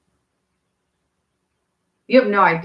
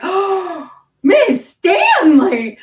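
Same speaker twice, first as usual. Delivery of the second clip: high, drawn-out shocked cry of a name; shocked gasp; 11 semitones higher, 5 dB louder, much slower than usual. Retold or imitated. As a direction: gasp it out, slow and scandalized. Class gasps at teacher.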